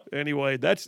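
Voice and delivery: ordinary delivery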